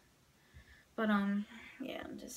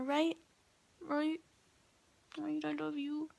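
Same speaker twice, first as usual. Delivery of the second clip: higher-pitched doting voice; affectionate pet talk; 6 semitones higher than usual. Real, not acted. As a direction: coo to your dog, seeking comfort. Cooing affectionately to her dog.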